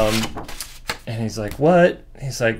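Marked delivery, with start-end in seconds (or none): 1.5-2.0 high, loud, rising question; mock disbelief, pitched up; baffled. Hero baffled by the technical explanation, baffled.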